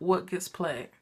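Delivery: ordinary talk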